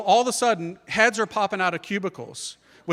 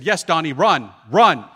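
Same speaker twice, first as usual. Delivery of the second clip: loud "run" as mom; retold mother's loud urging; urgent command; 8 dB louder than usual. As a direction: urge your son off, raising your voice.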